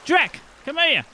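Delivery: high calling out to Drek. Acted. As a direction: yell for your brother across the river. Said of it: "she goes".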